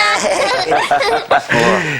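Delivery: merry laugh. Acted. Laughs after the song.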